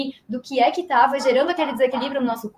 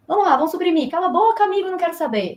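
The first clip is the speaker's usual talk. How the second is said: higher, mock-impatient self-talk; dismissive brush-off; 5 semitones higher than usual. Patient silencing a symptom, dismissive. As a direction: shut the friend up, pitched up and hurried along.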